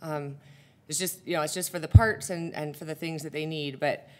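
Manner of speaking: ordinary talk, nothing marked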